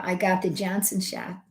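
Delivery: ordinary talk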